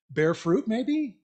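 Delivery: normal talking voice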